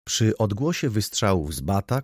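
plain reading voice

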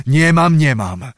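first cry loud and high, then dropping; desperate admission. A narrator's reading. Cossack admits to witch his powerlessness, desperately. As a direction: burst out, then sink in defeat.

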